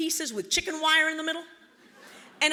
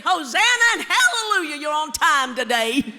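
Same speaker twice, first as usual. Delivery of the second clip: high, loud hosanna and hallelujah; church-lady jubilation at a punctual child; louder, gushing Baptist greeting; effusive praise; 4 semitones higher, 9 dB louder than usual. Retold or imitated. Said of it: "she'd say".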